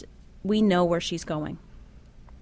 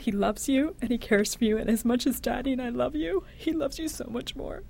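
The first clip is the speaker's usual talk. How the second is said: higher, tender soothing voice; comforting; 5 semitones higher than usual. Retold or imitated. Mother comforting her dying daughter, tender and higher. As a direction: soothe a frightened child.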